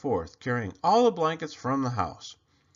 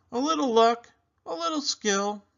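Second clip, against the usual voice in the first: higher, louder sly answer; smug expert; 11 semitones higher, 4 dB louder than usual. Acted. Morris answers his brother with a sly, high-voiced boast. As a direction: say it with a knowing wink.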